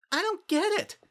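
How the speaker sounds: very high, puzzled squeak; confused Pinky. A narrator's reading. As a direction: blurt it out bewildered.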